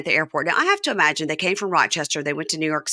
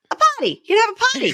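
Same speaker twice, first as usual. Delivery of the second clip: squeaky falsetto, far higher; high-pitched mock John; 4 dB louder, faster than usual. Host imitates John in a squeaky falsetto. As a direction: pitch it way up, eager and silly. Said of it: "he goes".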